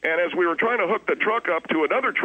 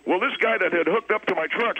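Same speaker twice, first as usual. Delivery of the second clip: ordinary talk, nothing marked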